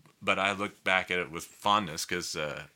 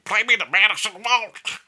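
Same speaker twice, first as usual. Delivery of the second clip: strained dysphonic voice, pushed high and loud; 14 semitones higher, 9 dB louder than usual. Retold or imitated. Fiddler imitating his idol's phone call, loud high dysphonic voice. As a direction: do a weird impression of damaged vocal cords. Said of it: "do a weird voice, but he had vocal dysphonia".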